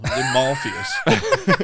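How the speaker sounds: high, slow laughing shriek; laughing shout. Real, not acted. Friend shrieking with laughter at the threat story. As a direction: crack up into a shout. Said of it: "All right, fucking hell".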